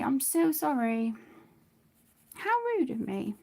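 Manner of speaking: ordinary delivery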